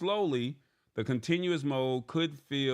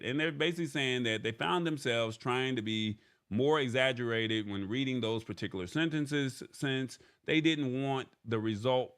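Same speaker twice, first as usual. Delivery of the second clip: ordinary talk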